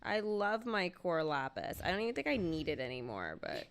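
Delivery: ordinary talk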